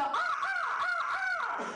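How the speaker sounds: very high, slow drawn-out caws of alarm; angry crow alarm caws. Retold or imitated. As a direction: caw like a mad crow sounding the alarm. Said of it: "That's mad, that's angry, that's alarm".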